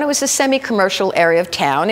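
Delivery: ordinary delivery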